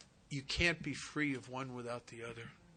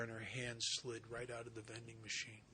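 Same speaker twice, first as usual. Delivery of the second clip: usual speaking voice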